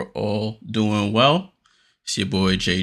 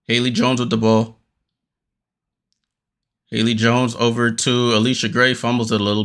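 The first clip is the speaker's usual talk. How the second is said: quick running commentary, rising on the action; live play-by-play; 2 semitones higher than usual. Acted. Fan calling the Liberty game live for viewers, quick play-by-play. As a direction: call each pass and shot as it happens.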